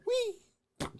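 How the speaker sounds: squealing whee; fly off with a squeal; high whee. Retold or imitated. Mech flying away.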